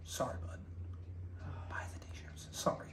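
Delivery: whispered sorry, very soft; sheepish whisper. Acted. To his buddy after yelling, sheepish whisper. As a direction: hush it like an embarrassed apology.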